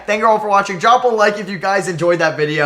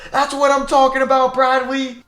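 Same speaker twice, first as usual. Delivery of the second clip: raised and loud, still buzzing; shouting praise at the shooter; excited praise; 8 semitones higher, 4 dB louder than usual. Real, not acted. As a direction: cheer your player on. Praises player after big shot.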